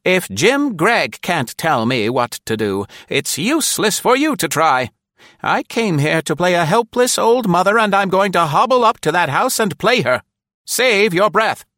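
put-on high, loud, imperious old woman; grand elderly lady voice, slowing at the end; majestic old lady. A narrator's reading. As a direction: put the young man in his place. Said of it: "Nora answered majestically".

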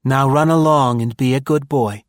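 bossy motherly woman voice; motherly order. A narrator's reading. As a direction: send him off like a fond mother. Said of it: "ordered this surprising woman".

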